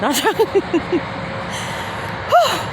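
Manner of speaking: real winded giggle at oneself; laughing. Real, not acted. Vlogger joking to viewers about losing weight, laughing.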